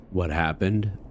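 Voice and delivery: ordinary talk, nothing marked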